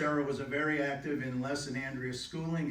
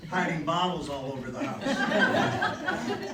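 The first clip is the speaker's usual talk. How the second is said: louder mock scolding, laughter around it; teasing scolding; 3 semitones higher, 5 dB louder than usual. Son or daughter ribbing Mom about hidden chocolate, mock scolding. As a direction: tease Mom with mild mock outrage.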